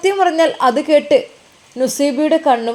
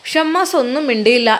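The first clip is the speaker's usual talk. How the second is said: plain reading voice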